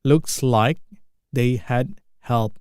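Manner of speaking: slow, deliberate model phrase; slow repeat. Shown as a demonstration. Teacher to students, slow model of the phrase. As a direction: stretch each word for learners. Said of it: "teacher repeats film line slowly".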